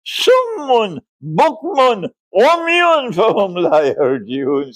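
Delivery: high, recited Arabic words "Summun, bukmun, umyun fahum la yarjiun"; Quranic recitation. Acted. Lecturer quoting the Quran to students, high recitation. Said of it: "says Allah".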